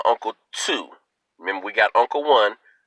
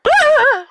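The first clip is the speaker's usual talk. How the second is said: squeaky laugh; 7 dB louder than usual. Retold or imitated. Laughing recalling grandma's noise.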